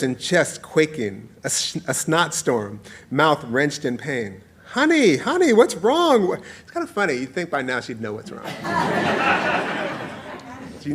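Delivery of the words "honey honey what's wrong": very high, singsong mom voice; acted motherly alarm, high-pitched; fretting mother. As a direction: coo anxiously to a sobbing child. Mother frets over inconsolable child.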